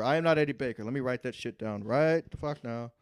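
usual speaking voice

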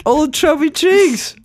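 high and slow, teasing; high drawn-out nickname. Acted. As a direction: stretch it out up high. Playful nickname.

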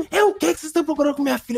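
hammed-up scolding mom; scary mother. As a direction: fire it off like an angry witch mother. Witch-like mother confronts kids.

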